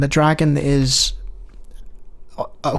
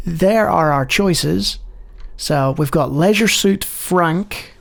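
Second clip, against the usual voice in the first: ordinary delivery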